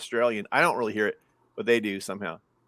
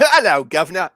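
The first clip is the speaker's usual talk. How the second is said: put-on Cockney accent; 8 dB louder than usual. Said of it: "Yeah, Cockney".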